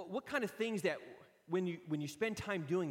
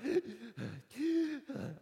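gasp; 9 semitones higher, 4 dB louder than usual. Retold or imitated. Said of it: "I'm like".

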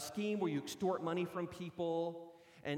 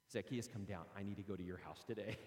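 gentle invitation; 10 semitones lower, 7 dB quieter than usual. Acted. Jesus speaking to Zacchaeus.

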